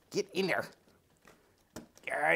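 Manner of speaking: usual speaking voice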